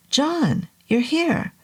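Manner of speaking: high pleased surprise; surprised greeting. Acted. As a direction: greet him brightly, surprised.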